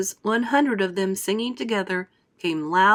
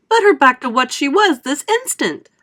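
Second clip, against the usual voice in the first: furious demand; 7 semitones higher, 9 dB louder than usual. A narrator's reading. Mother demanding.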